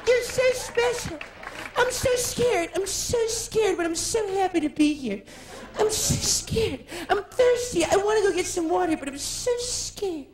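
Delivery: flutter as a nervous star; jittery diva. Retold or imitated. [name]-style nervous gushing.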